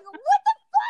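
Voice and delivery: high, loud yelp of disbelief; retold outburst. Patient recalling agony on the table, retold outburst. Retelling outburst during painful procedure.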